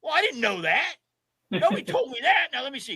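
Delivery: high, caught-off-guard protest; imitating flustered winner. Winner hearing the made-up ten-state rule, high flustered protest.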